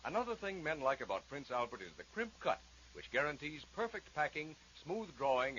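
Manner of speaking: ordinary delivery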